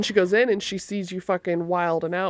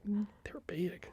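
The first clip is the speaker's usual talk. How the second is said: whisper; 14 dB quieter, much slower than usual. Real, not acted. Gossiping about a stranger's body.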